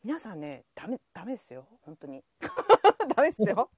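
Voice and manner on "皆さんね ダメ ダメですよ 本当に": hushed, pleading warning; urgent whisper. Pretend. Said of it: "やっぱ小声で言った方が".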